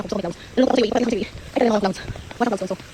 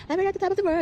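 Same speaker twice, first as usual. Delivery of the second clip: mock triumphant quote; 9 semitones higher than usual. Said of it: "So, parang".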